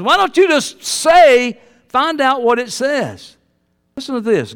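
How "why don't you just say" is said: ordinary talk, nothing marked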